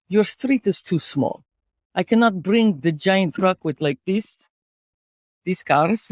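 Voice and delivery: put-on storyteller with a Russian accent. Host telling co-host about car accident, Russian accent. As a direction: tell a tale of woe in a Russian accent. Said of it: "could you do a Russian accent".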